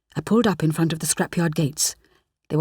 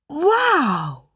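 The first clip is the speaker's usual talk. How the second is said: drawn-out wondering wow, higher; amazed wow; 8 semitones higher than usual. A narrator's reading. Rani peers through the glass, amazed. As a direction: marvel at what she sees. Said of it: "she said slowly".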